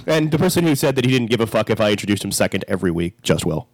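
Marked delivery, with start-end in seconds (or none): none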